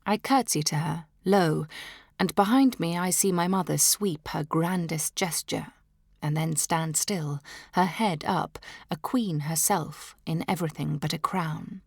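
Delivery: ordinary delivery, nothing marked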